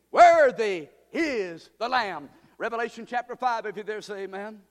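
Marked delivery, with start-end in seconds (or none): 0.1-2.3 slow shout, opening loud; cri de prédication. Shown as a demonstration. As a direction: shout it slowly like a rousing preacher. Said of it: "you can shout like me".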